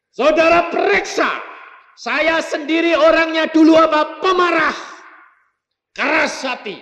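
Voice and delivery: loud, high testimony; preacher shouting his own past sins; fiery preaching. Acted. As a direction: call it out to the congregation.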